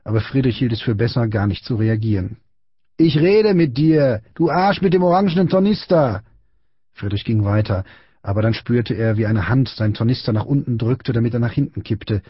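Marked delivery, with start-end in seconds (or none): 3.0-6.2 bullying shout. A narrator's reading. Big boy to Friedrich who ignores him, aggressive shout. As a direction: demand attention like a schoolyard bully.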